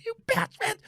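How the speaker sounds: high pitched angry yell. Retold or imitated. Fan swearing at the TV in a high yell. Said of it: "I must have said bitch thirty times".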